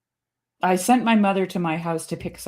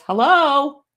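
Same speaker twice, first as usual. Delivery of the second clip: lightly voiced parrot, higher and louder; parrot hello; 8 semitones higher, 6 dB louder than usual. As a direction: chirp hello like a parrot calling out. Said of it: "like that".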